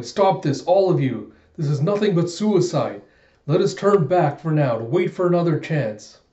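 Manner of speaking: pleading loudly with the troops; urgent plea. Acted. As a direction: call on all of them to stop. Momonosuke begs allies to stop.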